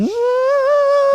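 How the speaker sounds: high, loud trumpeting elephant call. Retold or imitated. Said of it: "Michael's elephant right here".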